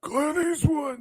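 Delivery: high, far-off shouted voice; hammed-up shout of the name; Clint Eastwood impression. Host doing Clint Eastwood voice for the guest, hammed up. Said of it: "he talks like this".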